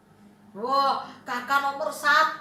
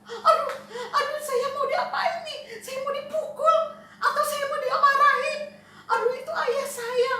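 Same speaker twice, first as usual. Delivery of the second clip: panicked son; 9 semitones higher than usual. Acted. Frightened son seeing his father run toward him, panicked.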